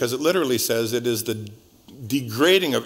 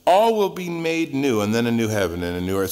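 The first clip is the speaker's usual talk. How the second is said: ordinary talk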